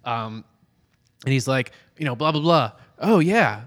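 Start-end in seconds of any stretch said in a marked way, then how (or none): 2.2-3.6 dismissive. Acted. Fred on the phone.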